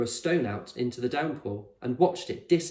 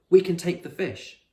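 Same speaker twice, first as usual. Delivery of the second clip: put-on higher, louder boy's voice; eager Fred; 7 semitones higher, 7 dB louder than usual. A narrator's reading. Fred offering the fish to his friends, eager. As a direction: pitch the idea keenly.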